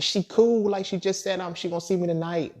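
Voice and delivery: schrill hoch und lauter, abwiegelnd; high, loud brush-off excuse; high defensive excuse. Retold or imitated. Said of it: "I was like".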